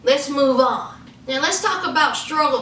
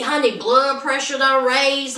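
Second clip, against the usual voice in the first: usual speaking voice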